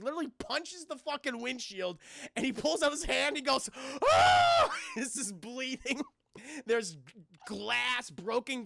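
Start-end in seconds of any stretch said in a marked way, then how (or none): 4.0-4.7 a sudden high, loud scream of pain. Retold or imitated. Arnold yelling after punching the taxi windshield, loud pained scream. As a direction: let it rip as the glass cuts in.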